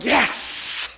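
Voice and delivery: hushed, breath-only victory "yes"; whispered cheer to yourself; private triumph. Retold or imitated. Student secretly celebrating a pass during the phone call, whispered triumph. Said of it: "But in the background, I'm going".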